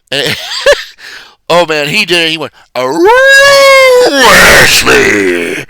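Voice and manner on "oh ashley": much higher and louder howling shout; werewolf howl. Retold or imitated. Voice actor calling Ashley in his werewolf character, howling shout. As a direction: howl her name like a werewolf. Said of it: "one of his characters is a werewolf".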